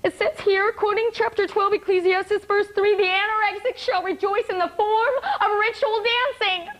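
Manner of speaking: high, preacher-like mock plea; impassioned appeal. Patients appealing to hospital head, Bible in hand, impassioned. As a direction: plead a case as if quoting scripture. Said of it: "appealing to the head of the hospital administration".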